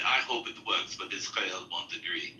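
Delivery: low murmured imitation. Quoting Igor again.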